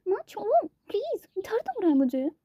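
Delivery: high, slow mock-pained whine; groaning in pain. A narrator's reading. Daughter with ear pulled by mother, whining in mock pain. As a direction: wince and plead, ear being pulled. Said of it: "Anvi दर्द से कराते हुए".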